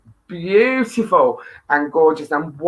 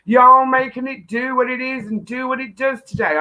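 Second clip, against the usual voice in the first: put-on American accent; 6 semitones higher than usual. Host greets her American friend, putting on an American accent. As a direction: try out an American accent, pitched up. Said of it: "chance to speak American when you pop on".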